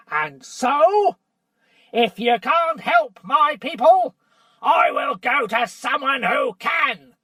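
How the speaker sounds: dwarf shouting defiance at the king; defiant shout. Acted. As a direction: shout the threat. Nickabrick threatens to leave.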